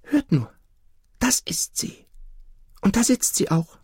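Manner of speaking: put-on high, hushed child's voice; excited little girl. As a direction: point it out, half whispering. Girl points out the bird.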